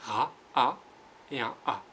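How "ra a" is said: Ra said loud and split into its sounds; sounding out syllable. Shown as a demonstration. Teacher at home, splitting the syllable for pupils. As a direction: pull Ra apart into R and A. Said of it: "Vous faites un R".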